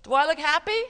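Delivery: upright, happy. Shown as a demonstration. Teacher shows children a good posture, loud and happy. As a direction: ask it brightly with a rising end. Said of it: "what if I sit like this".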